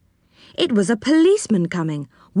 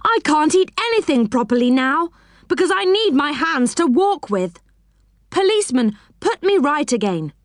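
put-on high-pitched, boyish protest; frustrated high-pitched plea; crying out in complaint; 6 semitones higher than usual. A narrator's reading. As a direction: complain high, pleading to be fixed. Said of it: "cried Joe".

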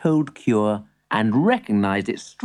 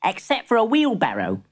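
put-on boy voice; 6 semitones higher than usual. Boy answers.

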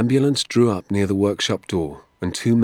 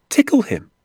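high, loud, incredulous repeat; astonished echo; 7 dB louder than usual. Acted. Boy astounded by the doctor's trout trick, incredulous echo. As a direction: throw it back in amazed disbelief.

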